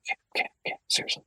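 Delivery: hushed unvoiced whisper. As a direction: lean in and whisper in a cinema. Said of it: "always leans over and goes".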